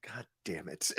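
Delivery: whispered God damn it under breath; whispered curse. Retold or imitated. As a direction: breathe it out defeated. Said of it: "And he goes".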